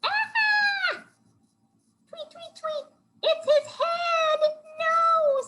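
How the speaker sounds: very high shrieking bird; scream, chirp, then wail slowly in falsetto; hammed-up squeaky bird panic; horrified bird. A narrator's reading. Bird character horrified in story.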